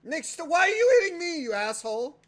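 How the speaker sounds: high, loud whiny cry; yelping complaint. Real, not acted. Yelping at Nick for hitting him, loud cry. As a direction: yelp it as if hit.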